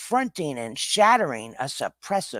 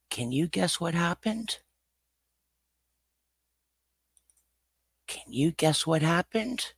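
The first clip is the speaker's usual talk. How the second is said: put-on kids show host; 5 dB quieter than usual. Mock children's TV storyteller.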